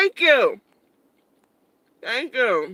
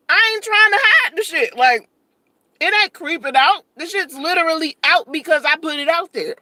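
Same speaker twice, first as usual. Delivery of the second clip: heated; 5 semitones higher, 3 dB louder than usual. Real, not acted. Heated defiant rant.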